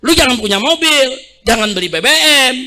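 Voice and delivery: hammed-up careless official; mock dismissive official. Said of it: "Apa dijawab pejabat".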